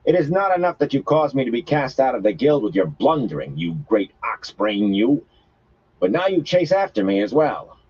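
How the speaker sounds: woman berating the man who got her expelled; odd foreign speech. A narrator's reading. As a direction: scold him as a foreign outcast. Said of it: "She had the same odd way of speaking".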